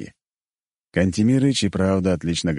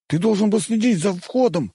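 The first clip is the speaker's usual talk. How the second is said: put-on teen growl; 14 semitones higher than usual. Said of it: "прорычал парень".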